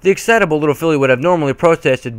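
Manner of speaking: plain reading voice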